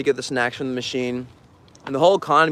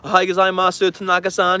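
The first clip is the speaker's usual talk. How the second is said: polite Japanese greeting; 5 semitones higher than usual. Retold or imitated. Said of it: "I'd be like".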